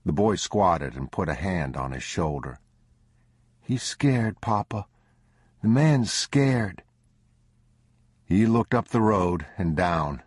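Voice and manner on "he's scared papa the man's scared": put-on higher child voice, gently begging his father; a boy asking mercy for a stranger; pleading young boy. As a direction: son pleads with father for frightened old man.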